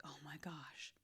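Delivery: breathless unvoiced whisper of shock. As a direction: gasp it out under your breath. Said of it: "she was like".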